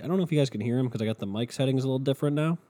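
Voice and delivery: usual speaking voice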